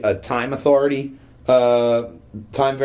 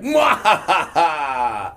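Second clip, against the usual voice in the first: slow evil cackle; mad scientist cackle; 4 semitones higher, much slower than usual. Retold or imitated. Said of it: "he's like".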